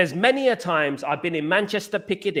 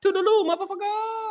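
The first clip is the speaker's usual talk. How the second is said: Chow impression. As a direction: squeal it up high like Chow, stretching motherfucker. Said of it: "as soon as someone does something you don't like".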